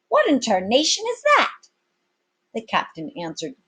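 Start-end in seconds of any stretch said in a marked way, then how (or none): 0.1-1.7 astonished sailor. Acted. Sailor astonished by the captain's plan, very high and loud. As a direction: bellow in disbelief.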